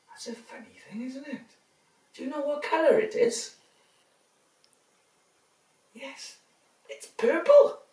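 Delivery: lively storybook reading; reading to children. A narrator's reading. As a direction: read it big for little ones.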